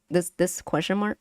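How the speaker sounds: ordinary talk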